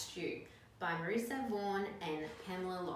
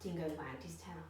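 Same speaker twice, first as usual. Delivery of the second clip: ordinary delivery, nothing marked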